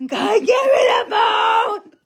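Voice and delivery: slow, high, loud pleading shout; desperate shout. Retold or imitated. Begging her friend for the phone, slow loud shout. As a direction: demand the phone in mock desperation.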